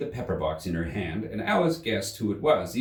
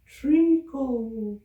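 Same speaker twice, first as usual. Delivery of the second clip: high drowsy squeak; sleepy Dormouse; 4 dB louder than usual. Acted. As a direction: mumble it half asleep. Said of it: "said a sleepy voice".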